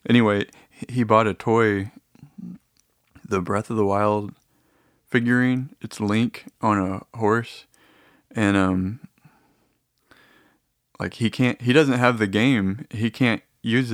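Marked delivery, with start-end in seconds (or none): none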